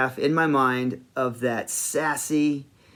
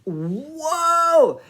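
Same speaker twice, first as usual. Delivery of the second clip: high, drawn-out amazed whoa; 11 semitones higher than usual. Retold or imitated. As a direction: relive being blown away by it. Retold reaction to amazing kiss.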